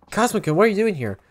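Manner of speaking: startled to see him; surprised. Acted. Fighter startled by Cosmo's visit, surprised.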